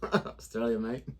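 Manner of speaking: lightly put-on Australian twang, laughing; mock Australian accent. Teasing the viewers about Australia, in a mock Australian accent. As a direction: say it like a joking Aussie. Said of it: "if you think about accents".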